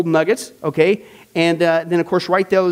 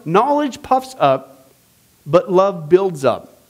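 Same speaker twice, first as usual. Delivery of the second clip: slow scripture quote; 3 semitones lower, much slower than usual. Acted. Quoting scripture slowly.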